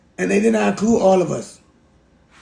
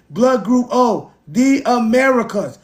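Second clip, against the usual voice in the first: slow and higher, each word spelled out; slow emphatic reading; 4 semitones higher, slower than usual. Shown as a demonstration. Showing the chat the chart, slow and higher. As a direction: read "Blood group O, the Americas" slowly like a verdict. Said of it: "rereads the chart line".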